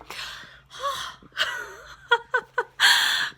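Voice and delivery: long, high tearful gasp; shuddering sigh through real tears; crying. Real, not acted. Reader overcome by a character's death, crying gasp. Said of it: "totally crying".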